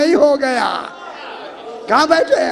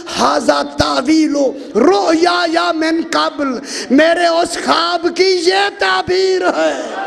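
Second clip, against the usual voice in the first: slow, raised Quranic chant then translation; 4 semitones higher, slower than usual. Acted. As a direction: intone it like a preacher quoting scripture. Yusuf quotes verse to father.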